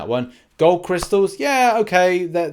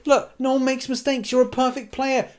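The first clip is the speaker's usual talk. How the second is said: much higher, airy mock voice; fake-cheer it, pitched way up; sarcastic mock praise; 9 semitones higher than usual. Vlogger mocking players who never make mistakes, sarcastic high voice. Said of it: "it'd be great to say".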